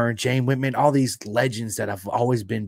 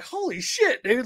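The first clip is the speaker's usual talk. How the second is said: amazed exclamation; 13 semitones higher, slower than usual. Retold or imitated. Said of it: "he was like".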